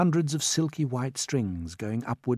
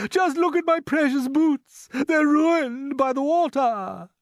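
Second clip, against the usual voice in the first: high, loud indignant wail; distressed cry; 7 dB louder, slower than usual. A narrator's reading. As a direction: lament loudly, stretching the words. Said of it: "cried the centipede".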